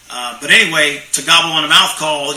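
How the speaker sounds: usual speaking voice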